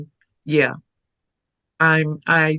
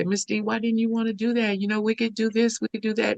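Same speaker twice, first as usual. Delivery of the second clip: higher, softer questioning; gently asking; 6 semitones higher, 5 dB quieter, faster than usual. Retold or imitated. Student softly asking her teacher why, higher. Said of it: "And I'd say".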